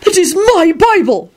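high-pitched, slow indignant shout; angry shout. A narrator's reading. Parks claiming his Bible back, angry. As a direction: hammer out the accusation. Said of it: "Park shouted angrily".

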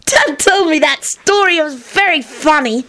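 put-on high, gleeful little-kid voice; cackling child. A narrator's reading. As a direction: crow with amusement like a small child. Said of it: "Teddy cackled".

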